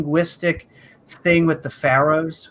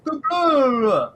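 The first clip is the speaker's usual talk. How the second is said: mock caveman grunt; 9 semitones higher than usual. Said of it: "they were like".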